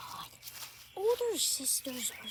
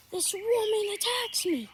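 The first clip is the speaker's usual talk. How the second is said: indignant shout; 8 semitones higher, 9 dB louder than usual. Pretend. Complaining shout about sister.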